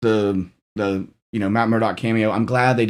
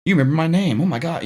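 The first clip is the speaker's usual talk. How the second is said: timid, shy character, pitched higher; Max; 7 semitones higher than usual. Retold or imitated. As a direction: lightly imitate a starstruck, shy guy in a higher voice. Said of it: "timid and shy and".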